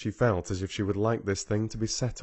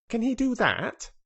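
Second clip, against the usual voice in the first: much higher, eager little question; 13 semitones higher, 5 dB louder than usual. A narrator's reading. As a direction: ask it bursting with eagerness. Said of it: "he asked eagerly".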